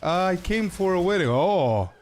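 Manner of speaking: high, friendly mock Cypriot local; Cypriot accent. Local offering halloumi to a wedding guest in a Cypriot accent. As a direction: put on a hospitable Cypriot voice. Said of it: "That's so accurate".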